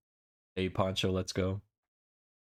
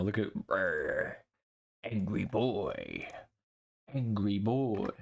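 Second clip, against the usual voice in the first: mock angry; much slower than usual. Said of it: "Oh, I'm angry right now".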